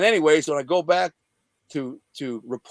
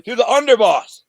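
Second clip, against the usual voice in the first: loud, high-pitched incredulous exclamation; sudden raised-voice dismay; aghast outburst; 8 semitones higher, 9 dB louder than usual. Retold or imitated. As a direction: burst out in disbelief at your own blunder. Aghast at whom he threatened.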